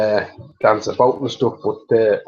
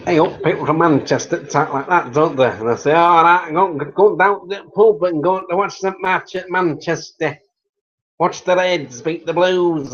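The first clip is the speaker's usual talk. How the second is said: higher-pitched Manchester impression; hammed-up Manchester accent, pitched up; 6 semitones higher, 3 dB louder than usual. Shown as a demonstration. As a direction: put on a lively Manchester football-fan voice. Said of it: "Maybe a still Manchester voice".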